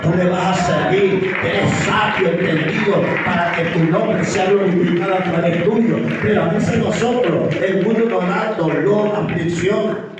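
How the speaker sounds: loud, emphatic pulpit exhortation; forceful preaching. Real, not acted. Preacher tells believers they are victors and children of God, forceful preaching. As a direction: drive each phrase home at full force.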